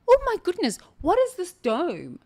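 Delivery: astonished at the dome; surprise. Retold or imitated. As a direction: gasp with astonishment. Sudden discovery in the desert.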